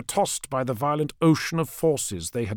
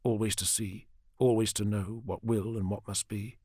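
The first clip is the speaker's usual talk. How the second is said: soft, lowered voice; quiet musing, kept to himself; inward thought; 4 semitones lower, 8 dB quieter than usual. Acted. Commander pondering the burden of foresight, hushed inward thought. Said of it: "he thought".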